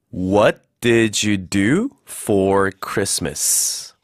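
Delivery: very slow, word-by-word model sentence; slow model reading. Shown as a demonstration. Teacher modelling the sentence for learners, slow and deliberate. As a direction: stretch each word out for learners.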